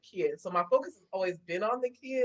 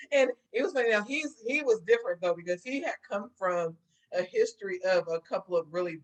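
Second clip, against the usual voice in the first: usual speaking voice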